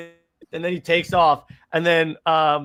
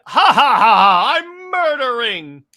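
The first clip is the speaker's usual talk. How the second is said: hammed-up evil cackle, higher and louder; mock villain laugh; 8 semitones higher, 9 dB louder, slower than usual. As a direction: cackle like a gleeful killer. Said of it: "it's not like he just killed him and go".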